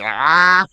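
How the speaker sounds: loud imitated yelp as the door shuts on his mouth; mock yelp of pain. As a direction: yelp like a door just closed on your mouth. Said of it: "And he's like".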